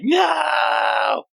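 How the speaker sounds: very high, drawn-out comic shout; hammed-up howl of refusal; mock protest wail. Acting her out as she is dragged back, wailing protest.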